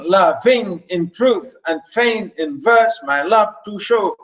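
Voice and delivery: measured verse demo, alternating weak and strong beats from "loving" to "show"; iambic scansion. Shown as a demonstration. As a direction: scan "loving in truth and fain in verse" with every second syllable stressed. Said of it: "unstressed, stress, unstressed".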